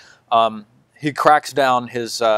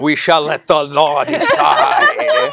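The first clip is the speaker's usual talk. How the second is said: mock zealot; 4 semitones higher, 6 dB louder, much slower than usual. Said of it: "he's like".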